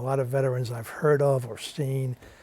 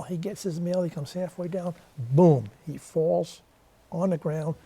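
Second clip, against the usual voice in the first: ordinary talk, nothing marked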